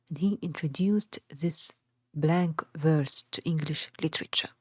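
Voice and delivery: ordinary talk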